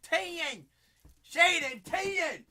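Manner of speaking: high, loud excited yell; thrilled card-break yell; excited shout. Real, not acted. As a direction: shout it out like a big hit. Excited hype over a big card.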